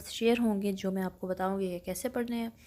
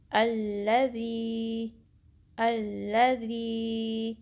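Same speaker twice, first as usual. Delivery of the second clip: अल्लज़ी recited slowly with ज़ी held for two counts; much slower than usual. Shown as a demonstration. Teacher recites अल्लज़ी slowly for students. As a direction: draw out अल्लज़ी like Quran recitation. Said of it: "आप भी मेरे साथ practice करें".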